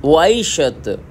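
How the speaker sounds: वाइट शर्ट said as wai shat; Korean accent. Shown as a demonstration. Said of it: "Korean लोग जो है इसको वाइट शर्ट".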